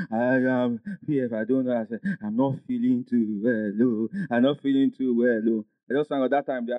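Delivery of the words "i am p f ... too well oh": lightly mimicked unwell caller, lower pitch; imitating a sick daughter. Daughter phoning pastor while sick, imitated feeble complaint. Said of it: "she was shaking".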